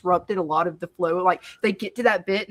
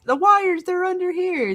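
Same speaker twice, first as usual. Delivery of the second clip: much higher, mocking voice; a squeaky imitation of the speaker; mock character voice; 10 semitones higher than usual.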